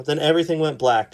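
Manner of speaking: ordinary delivery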